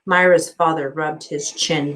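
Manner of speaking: plain reading voice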